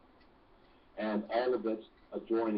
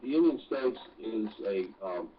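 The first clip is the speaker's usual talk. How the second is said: ordinary delivery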